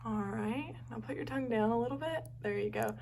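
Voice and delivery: ordinary talk, nothing marked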